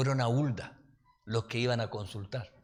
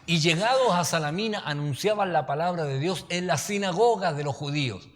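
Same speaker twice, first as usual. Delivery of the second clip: louder, formal reading of the Bible verse; scripture reading; 4 semitones higher, 5 dB louder than usual. Acted. Preacher reading Acts aloud to the church, declaiming. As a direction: proclaim the text to the congregation.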